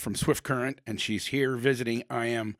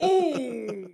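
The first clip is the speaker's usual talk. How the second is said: mock stung gasp; 9 semitones higher than usual. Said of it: "And I'm like".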